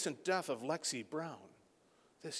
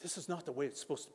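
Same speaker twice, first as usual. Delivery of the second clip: quiet lament; 12 dB quieter, much faster than usual. Retold or imitated. Jesus at the graveside thinking aloud, quietly. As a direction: say it softly at the grave. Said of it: "and he's going".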